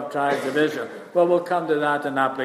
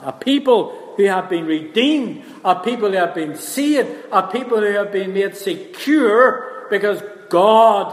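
raised voice, swelling on each repeated phrase; rousing preaching; 5 semitones higher, 4 dB louder than usual. Acted. Preacher describing a people redeemed and saved, rousing. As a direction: drive the list home with rising force.

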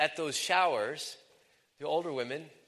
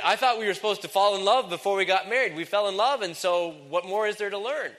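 higher, louder protesting quote; mock objection; 7 semitones higher, 7 dB louder than usual. Congregation objecting to learning love, mock protest. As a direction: voice a puzzled objector, pitched up and loud. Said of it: "'Cause you say".

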